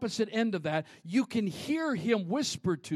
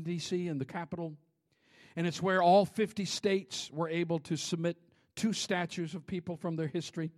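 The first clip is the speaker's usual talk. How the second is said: ordinary talk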